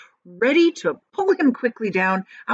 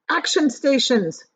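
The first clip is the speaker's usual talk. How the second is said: louder, higher command, a bit slow; shouted; 6 semitones higher, 4 dB louder than usual. A narrator's reading. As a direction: call it out like a captain. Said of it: "James shouted".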